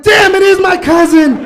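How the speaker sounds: loud exasperated shout; mad shout. Retold or imitated. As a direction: blurt it out in mock fury. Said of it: "I'm mad, but I'm like".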